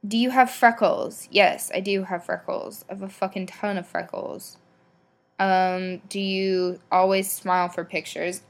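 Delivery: usual speaking voice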